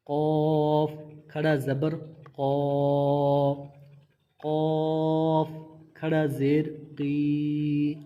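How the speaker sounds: each letter and vowel drawn out slowly and clearly; تلفظ کی مشق، آہستہ اور واضح; slow spelling drill. Shown as a demonstration. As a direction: spell it out for a beginner, stretching قوف. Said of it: "واضح فرق کر کے پڑھنا ہے".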